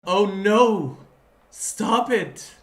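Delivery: loud, high cries of protest; high, full-voiced outburst; dismayed shout. Real, not acted. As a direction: yell in dismay at the game. Player cries out at game.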